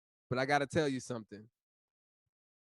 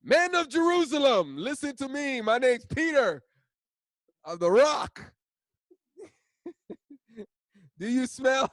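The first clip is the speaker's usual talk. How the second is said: high, loud proclamation; hammed-up street preacher call; Peter preaching; 9 semitones higher, 6 dB louder than usual.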